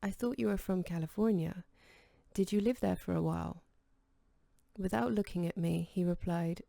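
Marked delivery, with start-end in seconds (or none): none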